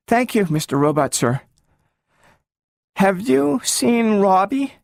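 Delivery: put-on a small girl asking after her lost friend; eager little girl. A narrator's reading. Gloria asks the talking robot about Robbie, high and hopeful.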